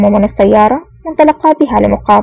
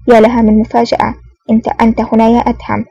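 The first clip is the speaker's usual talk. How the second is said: usual speaking voice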